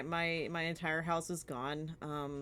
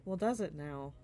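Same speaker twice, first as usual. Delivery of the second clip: normal talking voice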